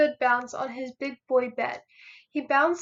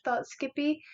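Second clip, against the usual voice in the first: ordinary delivery, nothing marked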